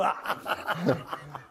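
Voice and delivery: breathless laugh. Real, not acted. Laughs at own story. (γέλιο με ανάσες)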